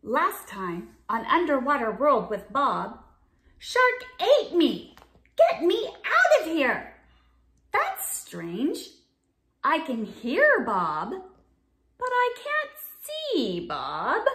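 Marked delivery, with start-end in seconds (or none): 3.6-7.0 high, loud panicked cry for help; Bob trapped in the shark. Acted. Bob cries out from inside shark. 7.7-14.2 puzzled fish. Acted. Puzzled character voice, slow.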